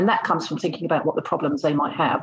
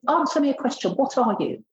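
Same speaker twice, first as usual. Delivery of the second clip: challenging; 6 semitones higher than usual. Retold or imitated. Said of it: "I said".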